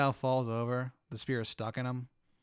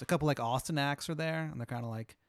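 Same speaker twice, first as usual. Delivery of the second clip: ordinary talk, nothing marked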